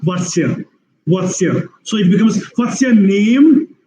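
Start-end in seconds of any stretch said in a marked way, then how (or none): 0.0-1.7 "what's your" run together as "wotsyuh"; reduced "your" glued onto "what's"; squeezed into one chunk. Shown as a demonstration. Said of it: "what is your becomes". 2.5-3.8 "What's your" squeezed, "name" stretched long and higher; long, prominent "name" after a reduced "What's your"; extended NAME. Shown as a demonstration. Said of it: "Name becomes extended part".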